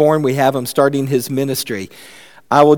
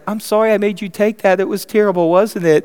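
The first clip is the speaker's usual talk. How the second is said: higher-pitched acted sympathy; sympathetic parent; 6 semitones higher than usual. Parent apologising for the medicine, sympathetic. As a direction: commiserate with the child.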